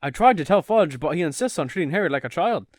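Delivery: sing-song Irish-tinged British; British accent slipping Irish. Acted. Reading Mr Weasley arguing with his wife, British accent turning Irish. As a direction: aim for British and veer into Irish. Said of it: "the Irish thing".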